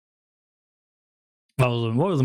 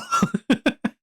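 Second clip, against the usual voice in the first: high squealing laugh. Real, not acted. Laughing fit.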